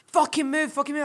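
high-pitched mock bark at him; angry yell. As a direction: snap it at him like an angry stranger. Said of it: "he was like".

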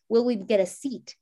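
ordinary talk

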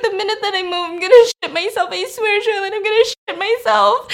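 high mock-crying wail. [name] swearing to Charlotte she can't move, mock crying. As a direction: imitate a tearful, panicked whine, pitch way up. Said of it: "She's fucking crying".

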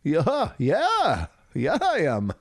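put-on drawled backwoods hunter accent. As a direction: play a proud country hunter. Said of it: "You like that accent?".

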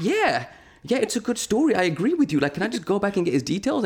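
high-pitched, mock-desperate pleading; exasperated agreement. Storyteller pleading as he is led out, high mock exasperation. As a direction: agree in a strained, high squeal. Retold frustrated reply while ushered out.

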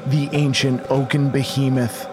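ordinary talk, nothing marked

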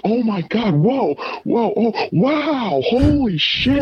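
very high mock amazed squeals; imitating her amazed gasps; amazed girl. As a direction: squeal in wide-eyed amazement. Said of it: "she's like".

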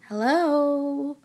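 high calling hello. Acted. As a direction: call into the house. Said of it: "called out for her friends".

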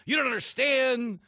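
hysterical media. Retold or imitated. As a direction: whine it like a frantic pundit. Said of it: "screaming fits from the media".

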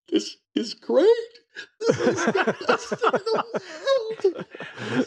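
high, laughing glee; giddy delight. Retold or imitated. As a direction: squeal with rookie joy. Rookie thrilled after the brawl.

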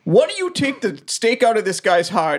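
character voice. Acted. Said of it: "bring them back and say".

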